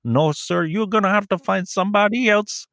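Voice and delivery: high, exasperated refusal; he's had enough of this caller, pitch going way up; imitating a fed-up salesman, high and sharp; disgusted salesman. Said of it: "And he's like".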